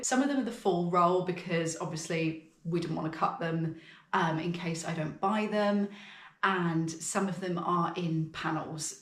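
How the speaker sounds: ordinary talk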